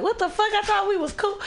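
high-pitched incredulous protest; hammed-up, high-voiced bafflement; acted disbelief. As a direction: squeal it like you've been betrayed by a friend. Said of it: "You're just like".

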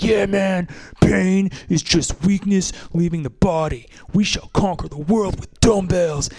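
slow, pumped-up gym trainer voice, lightly played; enthusiastic trainer. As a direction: hype me up like a cheesy personal trainer. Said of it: "he was, like, really enthusiastic".

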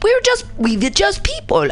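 clumsy, put-on German accent; attempted German accent. Host voicing ordinary Germans excusing themselves, in a bad German accent. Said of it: "I wish I could do better accents".

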